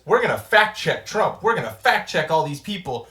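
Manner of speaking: sarcastic mimic of the network; mocking. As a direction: puff it up like a self-righteous anchor.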